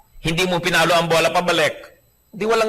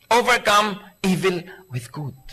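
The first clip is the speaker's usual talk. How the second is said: scripture reading; 3 semitones higher, slower than usual. Acted. Preacher reading Romans slowly to the congregation. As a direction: let the verse land slowly. Repeating verse.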